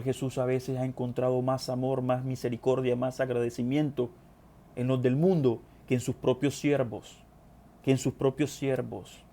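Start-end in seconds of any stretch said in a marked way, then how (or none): none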